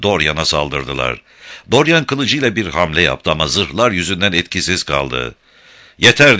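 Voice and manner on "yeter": loud growl. Acted. Dorian growling at the attackers, loud. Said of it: "diye homurdandı".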